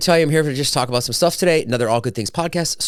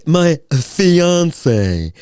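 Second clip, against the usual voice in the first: drawn-out, showy "fiance", said higher with a fancy French "fiɑnseɪ"; snooty fiance; 5 semitones higher than usual. Shown as a demonstration. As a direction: savour "fiance" like a status symbol. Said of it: "And I say it like that too".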